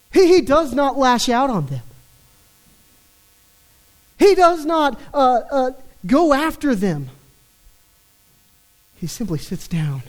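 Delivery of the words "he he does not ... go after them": louder, higher preaching push; rising emphasis. Real, not acted. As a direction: drive it home with force.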